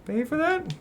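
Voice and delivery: put-on high, eager young crab voice; high-pitched kid crab; young crab Krill. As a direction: play the small hero, bright and curious.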